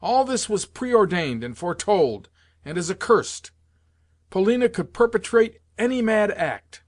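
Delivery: high-pitched, agitated tirade; impassioned, indignant outpouring; furious rant. A narrator's reading. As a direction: rail on heatedly, pitch riding high. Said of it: "I shouted in my fury".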